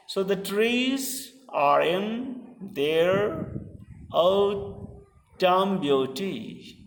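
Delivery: slow verse reading with a pause after each foot; measured, broken-up scansion reading. Shown as a demonstration. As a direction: read the line out slowly, foot by foot. Reading poem line foot by foot.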